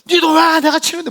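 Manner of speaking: high, loud defiant yell; young man shouting back. Retold or imitated. Big young man yelling back at the shaman. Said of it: "막 싸우고 막 그러더라고요".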